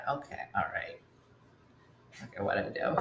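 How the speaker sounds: low, soft, worried mumble; nervous. Retold or imitated. Nervous reply to serious talk.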